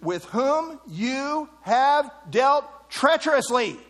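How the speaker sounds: slow, weighty stress on "you have dealt treacherously"; emphatic scripture reading. Shown as a demonstration. Preacher stressing the warning to the men, emphatic. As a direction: set each word apart, leaning hard on "treacherously". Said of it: "Note this emphasis".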